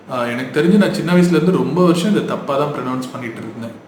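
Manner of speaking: normal talking voice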